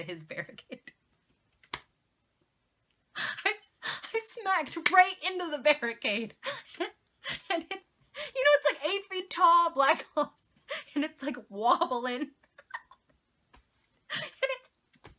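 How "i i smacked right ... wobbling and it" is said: high-pitched, laughing through the words; helpless laughter breaking up the telling; laughing hard. Real, not acted. Telling how she crashed into the barricade, laughing hard. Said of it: "I'm laughing so hard".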